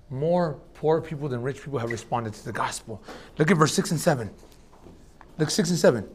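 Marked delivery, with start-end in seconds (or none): 0.1-3.0 much softer, lower repeat; softly repeating the point to the church; quiet restatement. Shown as a demonstration. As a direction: say it again gently, almost to yourself. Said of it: "that more poor people".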